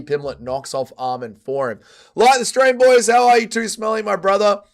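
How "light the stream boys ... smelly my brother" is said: high, loud streamer hello; loud hyped greeting. Real, not acted. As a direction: greet the chat with big energy. Streamer greets chat loudly.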